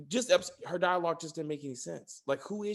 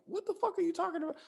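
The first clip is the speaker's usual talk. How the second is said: high, fast, softer baffled retort; mock disbelief; 13 semitones higher, 6 dB quieter, much faster than usual. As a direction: rush out an incredulous question up high. Retells own baffled reaction.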